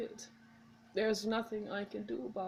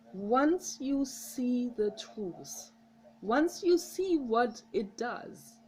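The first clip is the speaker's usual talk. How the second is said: emphatic; 4 semitones higher, 6 dB louder, much slower than usual. Real, not acted. Urging viewer to quit alcohol.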